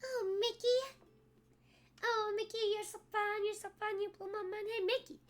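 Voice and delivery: high cartoon mouse voice; Minnie voice. Minnie cooing at Mickey for the stream. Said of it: "I know my Minnie will be better".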